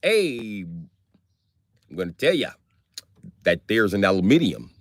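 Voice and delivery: mild mock Canadian accent; easygoing, lightly put-on Canadian accent. Canadian telling you there's an aluminium, mild Canadian accent. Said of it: "Canadians be like".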